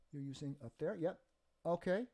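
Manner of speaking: usual speaking voice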